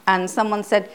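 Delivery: ordinary talk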